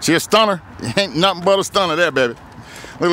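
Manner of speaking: usual speaking voice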